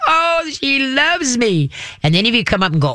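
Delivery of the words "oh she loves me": very high, slow delighted dog voice. Acted. Dog thinks it is being praised, delighted. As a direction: gush high and drawn out as a happy dog. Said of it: "the dog's gonna be like".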